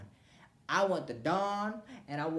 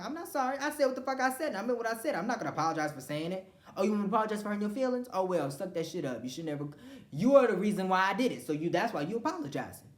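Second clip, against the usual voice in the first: high, loud, indignant; heated defiant retort; 5 semitones higher, 4 dB louder than usual. Retold or imitated. To the kid she was made to apologize to, heated and indignant. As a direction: throw the refusal back at them. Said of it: "but I'm like".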